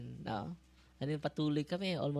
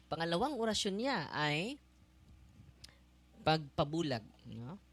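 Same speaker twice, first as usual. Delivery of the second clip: normal talking voice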